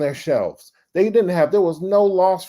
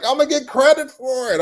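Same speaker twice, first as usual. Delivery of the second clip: much higher, a bit louder hopeful cry; giddy, sure he will be credited; excited; 12 semitones higher, 5 dB louder than usual. Retold or imitated. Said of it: "I'm looking around like".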